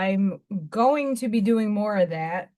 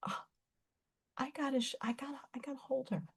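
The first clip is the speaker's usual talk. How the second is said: hushed urge; 15 dB quieter, much faster than usual. Retold or imitated. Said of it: "I'm like".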